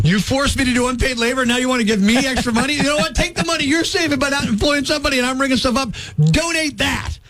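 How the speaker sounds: high, mounting comic outrage; indignant rant. Acted. Customer refusing checkout's charity request, indignant rant. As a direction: build to a high, punched final demand.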